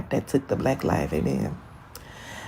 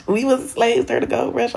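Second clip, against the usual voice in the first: normal talking voice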